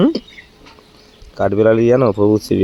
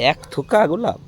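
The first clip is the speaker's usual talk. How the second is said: disbelief; 9 semitones higher than usual. Real, not acted. Husband repeats in disbelief.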